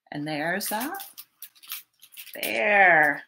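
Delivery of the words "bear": plain reading voice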